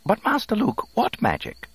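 high-pitched, fussy robot objection; protesting droid. A narrator's reading. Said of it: "the droid protested".

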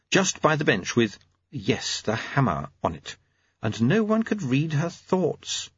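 ordinary delivery

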